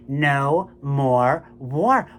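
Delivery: slow, loud chanted protest cry; protest shout. Retold or imitated. Performer leading the crowd's antiwar chant, slow loud shout. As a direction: drag each word out like a rally chant. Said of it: "I am shouting. We are all shouting".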